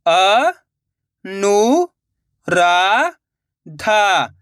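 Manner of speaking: रु-का-व-ट spoken one slow drawn-out syllable at a time. Shown as a demonstration. Teacher sounding out a word for children, syllable by syllable. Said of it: "teacher sounding out syllables".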